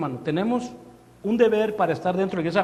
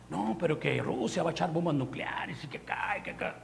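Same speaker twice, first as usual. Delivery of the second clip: hushed, worried whisper; anxious whisper; 7 dB quieter than usual. Retold or imitated. Churchgoer fearing Russian bombs, in an anxious whisper. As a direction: whisper it like a frightened rumor.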